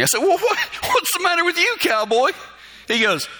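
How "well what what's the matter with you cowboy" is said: mock teasing. Teasing buddy after his request.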